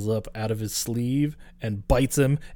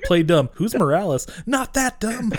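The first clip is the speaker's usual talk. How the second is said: higher, louder playing-dumb voice rising to a squeak; mock nervous teen; 8 semitones higher, 3 dB louder than usual. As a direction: bluff badly, then catch yourself high. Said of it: "he's like".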